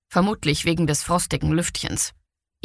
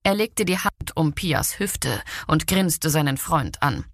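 ordinary delivery

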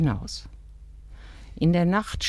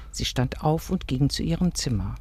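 ordinary delivery, nothing marked

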